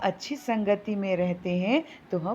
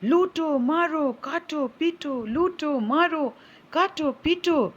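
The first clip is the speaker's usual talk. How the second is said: high-pitched, insistent chant of commands; shouting parrot; 7 semitones higher than usual. A narrator's reading. Parrot raising the alarm at the king, high shouting. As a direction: cry out each word with a falling tune. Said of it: "तोता जोर-जोर से चिल्लाने लगा".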